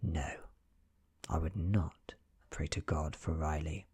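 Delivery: ordinary delivery, nothing marked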